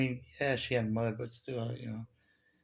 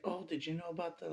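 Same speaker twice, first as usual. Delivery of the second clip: higher-pitched, eager interjection; imitated attention-grabbing; 6 semitones higher, 5 dB quieter than usual. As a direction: mimic someone trying to hold a guest's attention. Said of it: "get my attention".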